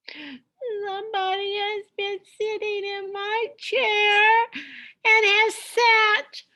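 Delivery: a wailing little cub who lost his porridge; little wee bear crying. Acted. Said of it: "cried the little wee bear in his little wee voice".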